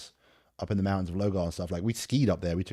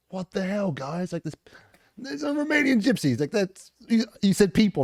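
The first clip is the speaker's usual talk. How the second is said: high, loud disbelieving outburst; shocked outrage; 12 semitones higher, 4 dB louder than usual. Retold or imitated. Soldiers finding people downrange, shocked high outburst. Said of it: "we're like".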